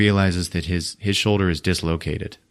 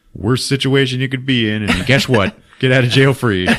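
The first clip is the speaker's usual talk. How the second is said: usual speaking voice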